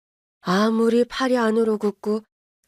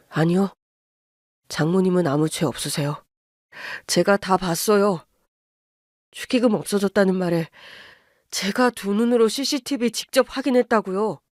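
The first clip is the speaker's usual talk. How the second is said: apologetic son-in-law. Acted. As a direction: hold it down, sorry but firm. Son voice again.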